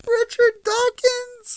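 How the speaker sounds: high, loud disbelieving question; can't believe what was just said; incredulous echo. Real, not acted. Friend echoes the name, high and loud in disbelief.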